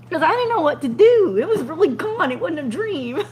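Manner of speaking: high, loud and frantic; panicked. Retold or imitated. Panicked retelling of stolen truck.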